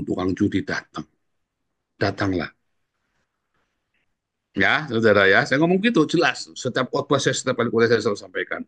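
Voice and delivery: ordinary talk, nothing marked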